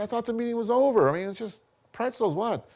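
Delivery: retold high-pitched indignant disbelief; indignant protest. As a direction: push back, can't believe it's about pretzels. Defending himself to angry boss.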